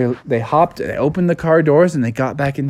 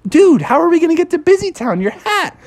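high, fast cartoon fretting; hammed-up incredulous frog; alarmed protest; 12 semitones higher than usual. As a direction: protest in a rush, pitched way up. Froggy protests to the driver.

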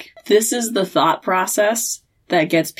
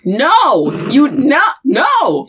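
mock shouted warning; 14 semitones higher than usual. Podcaster yelling at Sam not to trust demons, frantic shout. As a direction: yell it like stopping a disaster, pitch climbing.

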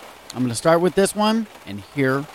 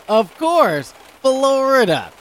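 knowing sarcasm; 8 semitones higher, 6 dB louder, much slower than usual. Real, not acted. Wry remark on the news.

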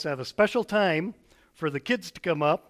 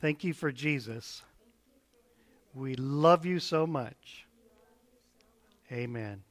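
put-on children's prayer; 3 dB quieter than usual. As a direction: pray gently, line by line. Leads kids in repeat-after-me prayer.

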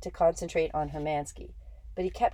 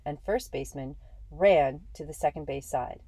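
usual speaking voice

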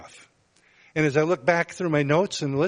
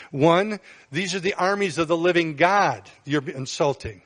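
usual speaking voice

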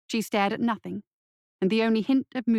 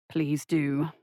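low, calm doctor voice; 6 semitones lower than usual. Acted. Church replies to Reginald.

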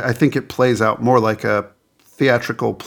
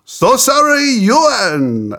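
very high, hammed-up French accent; 8 semitones higher, much slower than usual. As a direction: apologize like a French-accented game show host. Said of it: "when I do French accents".

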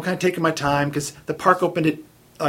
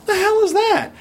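much higher, stunned outburst; baffled. Retold or imitated. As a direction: blurt it out in total disbelief. Baffled self in story.

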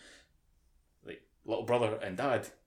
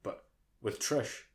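ordinary delivery

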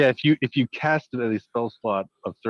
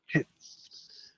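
whisper; 12 dB quieter than usual. Real, not acted. Excited hushed reaction.

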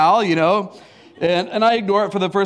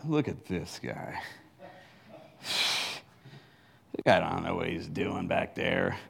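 muttered to himself; 14 semitones lower, 13 dB quieter than usual. Retold or imitated. Muttered thought at rear driver.

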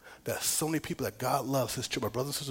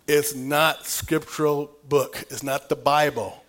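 intense preaching; 2 semitones higher, 9 dB louder, much slower than usual. Acted. Emphatic aside in sermon.

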